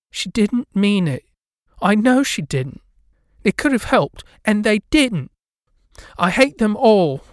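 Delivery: high, loud and hurt; upset ghost girl. A narrator's reading. Ghost girl upset in the street, high and loud. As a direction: defend her mother through anger. Lydia upset defending her mother.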